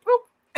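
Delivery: high, loud cartoon boop; mock boop. Imitating the screen cutting off, a high loud boop. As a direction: pop the sound out like a toy.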